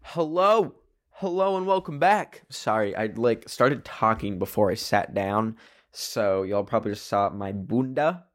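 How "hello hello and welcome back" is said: host voice. Pretend. Welcoming back after break, host.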